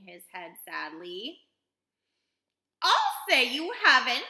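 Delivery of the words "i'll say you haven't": high, loud indignant cry; indignant mother crying out; cried out. A narrator's reading. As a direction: cry it out at full voice. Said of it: "cried Missus Beauregard".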